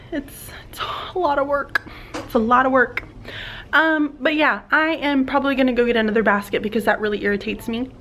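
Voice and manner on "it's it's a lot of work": soft whispered complaint; whispering. Real, not acted. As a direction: whisper it like a confession. Whispered complaint, then repeated aloud.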